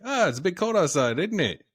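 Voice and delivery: mock British, high and bright in pitch; British accent. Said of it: "That's a British thing".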